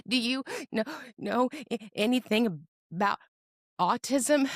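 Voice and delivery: high, halting, stammered question; tentative question. Retold or imitated.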